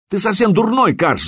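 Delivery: резко выше, гневный крик; взорвись криком на обидчика; закричал. A narrator's reading. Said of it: "закричал Вильд". (гневный окрик брата)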